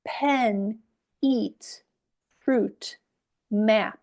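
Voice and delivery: one word at a time, clear pause after each; spaced-out teacher's model for repeating; modelled word list. Shown as a demonstration. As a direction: read each word as one separate falling beat. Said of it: "And then say the words".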